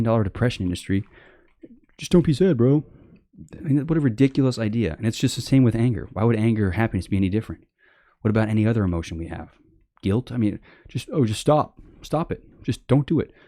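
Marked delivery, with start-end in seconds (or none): 2.0-2.8 higher-pitched mocking "bro" voice; mock glib advice. Mocks glib advice to depressed people. 11.1-13.3 higher, dismissive imitation; mock glib advice. Mocks telling people to stop feeling.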